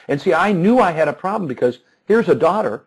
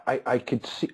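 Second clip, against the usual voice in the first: ordinary talk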